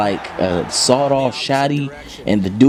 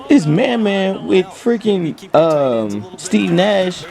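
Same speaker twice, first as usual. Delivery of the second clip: normal talking voice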